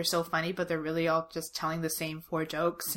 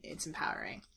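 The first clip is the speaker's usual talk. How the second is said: sarcastic; 3 semitones lower, 12 dB quieter than usual. Pretend. Sarcastic mock praise.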